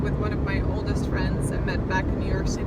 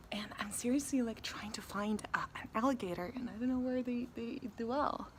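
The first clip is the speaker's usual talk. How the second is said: hushed whisper while searching; 13 dB quieter than usual. Real, not acted. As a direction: whisper so the alligators won't hear. Hunting alligators in swamp.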